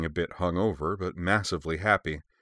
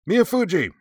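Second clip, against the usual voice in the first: sharp, much higher, louder bark; barked name; 13 semitones higher, 7 dB louder than usual. A narrator's reading. Major calls her recruit with a loud bark. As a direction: snap her name out like an order. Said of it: "Mio barked".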